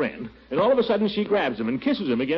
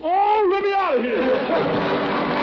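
high, drawn-out panicked wail; panicked cry; 10 semitones higher, 3 dB louder, much slower than usual. Acted. Panicked escape from kiss.

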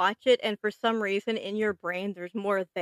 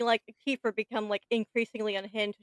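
ordinary delivery

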